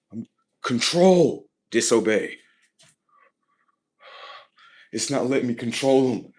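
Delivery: loud, high-pitched mock outburst; upset system outburst. The system rages at losing control, upset shouting. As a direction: blurt it out as a thwarted, upset machine.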